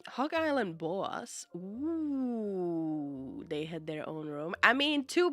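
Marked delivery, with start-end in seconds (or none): none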